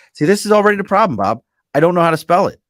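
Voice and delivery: loud, high-pitched protest; worked-up, half-joking outburst; playful exasperation. Real, not acted. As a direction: complain loudly in comic dismay. Mock despair over a misspelt name.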